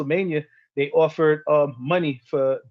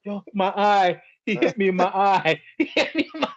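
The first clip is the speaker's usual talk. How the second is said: higher, whiny mock complaint with a laugh breaking in; imitating hurt wrestler; 6 semitones higher than usual. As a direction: whimper about your eye, hammed-up. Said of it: "He's like this".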